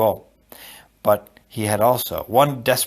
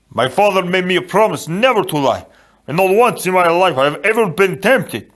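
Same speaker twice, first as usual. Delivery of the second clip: higher, louder, insistent character voice; indignant protest; 7 semitones higher, 5 dB louder than usual. A narrator's reading. Fezzik telling Inigo he never lies, indignant. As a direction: protest it with wounded principle, pitched up. Fezzik argues about lying.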